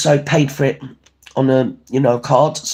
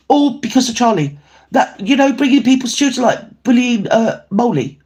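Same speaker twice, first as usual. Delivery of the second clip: higher, heated and fast-rising; indignant outburst; 8 semitones higher than usual. Real, not acted. Vlogger venting to viewers about a troll, indignant. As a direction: let the outrage spill over.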